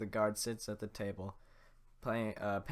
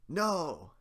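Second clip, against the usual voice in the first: louder, higher attempt at Shrek; first try at the ogre's voice, louder; 8 semitones higher, 9 dB louder than usual. Shrek starts talking to the villagers, in a hammed-up ogre voice.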